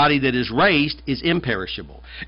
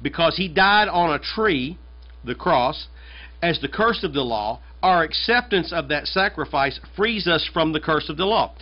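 ordinary delivery

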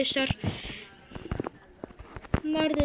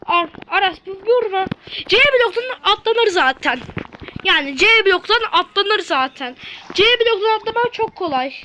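loud insisting; 4 semitones higher, 7 dB louder than usual. Real, not acted. Insisting to friend.

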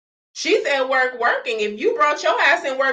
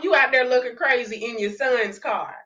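usual speaking voice

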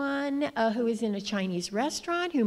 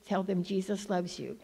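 ordinary talk

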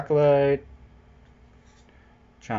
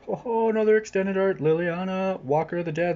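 excited, higher; 7 semitones higher than usual. Real, not acted. Excited at new card.

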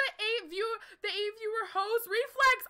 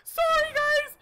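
high tearful shout; ecstatic crying; 10 semitones higher, 11 dB louder, slower than usual. Real, not acted. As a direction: cry out in joy. Winning the match.

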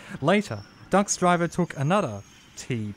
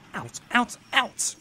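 high, angry shooing yell; 10 semitones higher, slower than usual. A narrator's reading. Signalman chasing the driver out of the signal box, yelling. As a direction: shoo him out with a yell. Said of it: "yelled the signalman".

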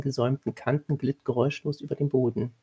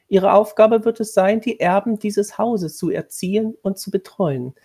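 higher, louder, formal commanding tone; stern lady of the house; 6 semitones higher, 6 dB louder than usual. A narrator's reading. Lady of the house instructing the new governess, stern and formal. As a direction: lay down the duties like a strict mistress.

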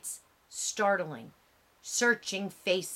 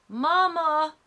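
much higher, louder desperate scream; crying for mama; 9 semitones higher, 7 dB louder than usual. A narrator's reading. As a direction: scream for his mother. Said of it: "Emmett is dragged from the truck".

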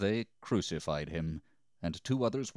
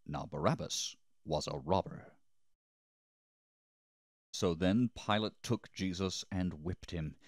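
usual speaking voice

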